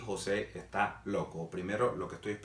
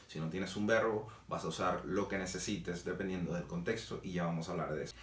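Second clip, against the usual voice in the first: normal talking voice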